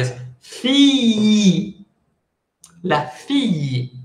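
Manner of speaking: fille with a long stretched i, like two i's. Shown as a demonstration. Teacher demonstrating fille to Spanish speakers, long stretched i. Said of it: "imagínense que es una I súper larga".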